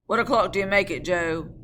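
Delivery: loud question; calling out. Acted. Guard asking Joe the time, loud. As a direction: shout the question over the noise.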